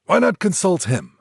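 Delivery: an excited cry; cried out. A narrator's reading. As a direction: burst out with the idea. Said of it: "he cried".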